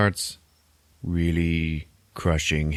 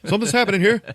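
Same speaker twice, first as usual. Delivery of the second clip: much higher, louder hammed-up alarm; mock panic; 14 semitones higher, 5 dB louder, faster than usual. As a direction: squeal it out like a scientist losing his cool. Hugh gripped by creature, retold.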